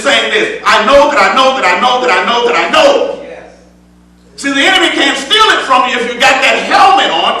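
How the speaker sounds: full-voiced preaching with rising fervor. Preacher exhorting the congregation in full preaching voice. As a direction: preach it out to the church.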